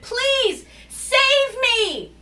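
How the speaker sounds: loud, high, drawn-out cry for rescue; shouted plea. A narrator's reading. Lost boy calling to the giant for rescue, shouted plea. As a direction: stretch each word out and shout it high. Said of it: "he had shouted".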